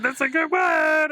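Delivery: high, drawn-out mock holler; excited announcement. Host acting out hearing the secret word, excited holler. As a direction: sing it out high and loud, stretched.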